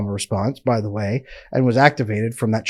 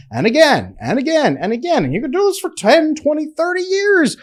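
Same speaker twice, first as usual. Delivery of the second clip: climbing higher and higher; rising mock pitch; 14 semitones higher than usual. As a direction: build it up sing-song. Mocking endless cycling.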